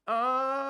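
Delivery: loud high ah; high scream demo. Shown as a demonstration. Coach showing the student the scream on the pitch. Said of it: "how loud you need to be".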